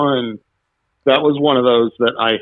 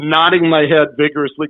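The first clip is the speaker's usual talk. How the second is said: ordinary talk, nothing marked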